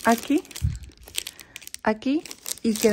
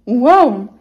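mock wow; 2 semitones higher, 10 dB louder than usual.

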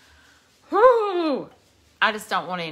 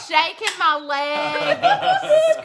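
laughing through words; 8 semitones higher, 4 dB louder, much slower than usual. Real, not acted. Laughing while retelling frog scare.

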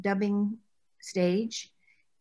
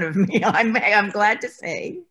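laughing; 2 semitones higher, 8 dB louder than usual. Real, not acted. Joking reply about nasal passages.

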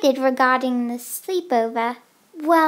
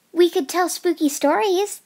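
ordinary talk

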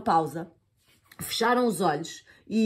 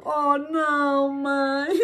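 sobbing; 7 semitones higher, much slower than usual. Acted. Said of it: "soluçou a Eva".

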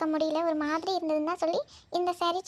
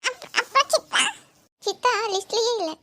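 put-on child voice; 4 dB louder than usual. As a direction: stammer like a small child. Voicing child teasing.

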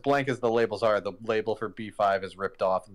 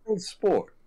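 put-on high, old-timey Mid-Atlantic accent; a Gatsby-style Mid-Atlantic "good sport"; 9 semitones higher than usual. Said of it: "He sounds like he should be in The Great Gatsby".

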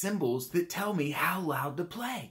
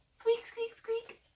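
very high little squeaks; mouse squeak; 4 dB quieter, slower than usual. Shown as a demonstration. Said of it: "squeak like a mouse".

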